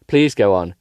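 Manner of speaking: usual speaking voice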